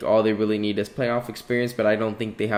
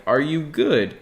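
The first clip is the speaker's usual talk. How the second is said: higher-pitched mock concern; imitating doubters; 6 semitones higher, 3 dB louder, slower than usual. People questioning his pick, in mock disbelief. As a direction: question it like a baffled onlooker. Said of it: "look at me and be like".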